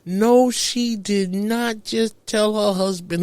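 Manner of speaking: high, slow "No, she didn't"; stunned and incredulous; disbelief. Retold or imitated. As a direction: draw it out in shocked disbelief. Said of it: "I'm like".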